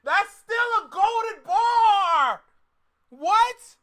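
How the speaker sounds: loud, high, slow shout of delight; real excited shout; hyped shout. Real, not acted. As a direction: yell it like a bar just hit hard. Hyped reaction to lyric.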